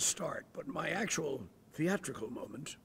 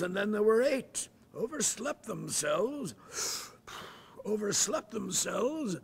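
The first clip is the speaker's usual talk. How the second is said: hammed-up British accent with the slip fart; bad British accent, fart blurted out; terrible British accent; 7 semitones higher, 7 dB louder than usual. Opening night before the nuns, in a bad British accent. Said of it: "terrible British accent".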